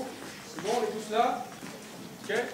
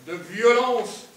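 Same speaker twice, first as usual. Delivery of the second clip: de violence stretched out slowly and hard; drawn out; 5 dB louder, much slower than usual. Shown as a demonstration. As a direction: lean into de violence, slow and heavy. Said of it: "Faite de désir".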